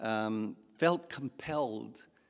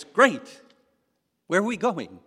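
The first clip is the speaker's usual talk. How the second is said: eager hobbit; 6 semitones higher, 7 dB louder than usual. Retold or imitated. Hobbit's eager question retold.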